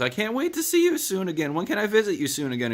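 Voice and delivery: high falsetto as the wife; mock high wife voice, relieved; wife's voice. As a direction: squeak it up like his grateful wife. Wife voiced in retold scene.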